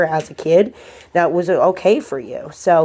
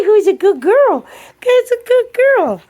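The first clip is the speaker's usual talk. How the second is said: gushing dog praise; 6 dB louder than usual. Real, not acted.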